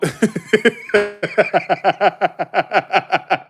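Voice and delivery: long giggling laugh; real, high rolling laugh. Real, not acted. Laughs at own remark.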